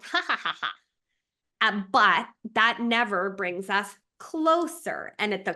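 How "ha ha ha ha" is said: high mock gloating ha ha; mock gloating laugh. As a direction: cackle like a smug ego. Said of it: "shame and ego ... be like".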